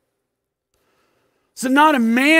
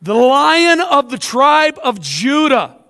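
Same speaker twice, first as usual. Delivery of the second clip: booming proclamation; 4 semitones higher, 5 dB louder than usual. Retold or imitated. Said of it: "one of the elders said to me".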